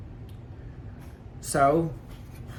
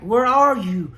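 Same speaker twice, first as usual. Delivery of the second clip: higher and louder, mimicking her furious yelling; angry yelling; 7 semitones higher, 6 dB louder, slower than usual. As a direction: shout it like the boss calling you lazy. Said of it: "Like, she was mad".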